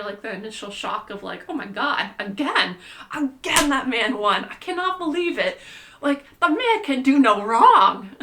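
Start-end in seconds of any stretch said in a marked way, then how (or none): 1.5-8.1 mock disbelief. Mock disbelief at his win.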